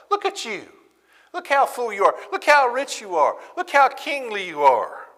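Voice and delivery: high mocking voice; sarcastic taunt. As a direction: taunt them about their riches. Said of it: "here's how we know it's sarcasm".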